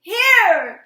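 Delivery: very high, very loud scream; piercing terrified cry; sharp scream. A narrator's reading. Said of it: "he screamed sharply".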